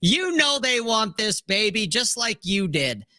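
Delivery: high, swaggering mock boast; playful cocky show-off, rising high at the end; cocky brag. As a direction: strut it, bragging about the guns. Cocky bragging continued.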